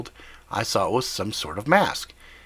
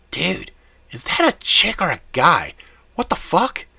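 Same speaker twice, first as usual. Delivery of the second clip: Jimmy's voice; 8 semitones higher, 4 dB louder than usual. Acted. Jimmy reacts over the headset.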